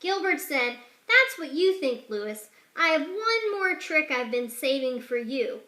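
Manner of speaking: ordinary delivery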